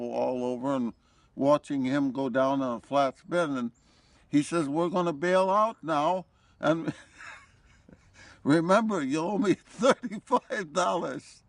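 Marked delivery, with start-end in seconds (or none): 4.7-11.4 chuckling through the retold line; amused retelling of a doomed pilot's joke; laughing while quoting. Retold joking intercom call.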